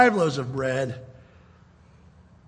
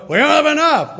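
higher, louder anxious complaint; fretting disciples; 9 semitones higher, 5 dB louder than usual. Retold or imitated. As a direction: panic a little about the food. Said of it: "they're all going".

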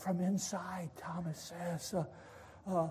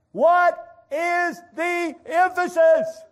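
"What is the emphasis" loud, high and slow, word by word; every word in "What is the emphasis" stretched and shouted; emphasizing; 12 semitones higher, 14 dB louder, slower than usual. Shown as a demonstration. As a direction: punch each word of "What is the emphasis" separately.

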